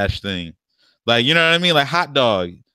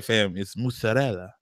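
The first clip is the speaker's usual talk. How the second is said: chef-style Italian; 7 dB quieter than usual. Retold or imitated. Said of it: "Put on the whole chef hat shit".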